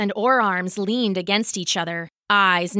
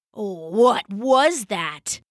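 heavy, very slow called-out question; a lightly voiced brute calling into the dark; slow Orarm challenge; much slower than usual. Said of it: "Orarm shouted".

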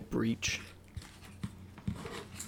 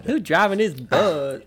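mock outraged; 6 semitones higher, 7 dB louder than usual. Mock outburst.

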